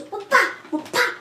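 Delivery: two high, quick "whoop-ah" bursts; imitated action noise. Moving Bella out of the way, high mock impact noises. Imitating repeated shoves of the car.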